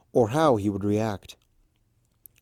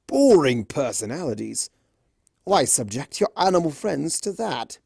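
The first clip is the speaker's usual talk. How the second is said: Discord voice; 3 semitones higher than usual. Acted. Discord persuading.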